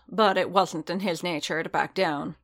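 ordinary delivery, nothing marked

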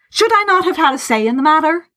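high-pitched, heated question; hot indignation. A narrator's reading. Laurence demands his say from his friend, said hotly. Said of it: "Lawrence said hotly".

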